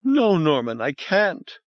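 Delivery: high, breaking woman's voice; tearful refusal. Acted. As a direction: refuse through sobs. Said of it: "she began crying again".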